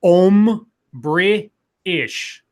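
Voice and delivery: "Um, British" broken into separate pieces; slow, syllable by syllable. Shown as a demonstration. Host demonstrates the trick slowly, "Um, British" in pieces. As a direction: draw out "Um, British" with gaps between syllables. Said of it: "And say it all really fast".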